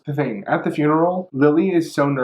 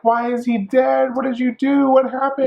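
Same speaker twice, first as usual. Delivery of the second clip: accusing crowd; 10 semitones higher than usual. Acted. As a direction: pile on her with shrill questions. Everyone accusing her after waking.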